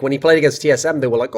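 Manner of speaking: usual speaking voice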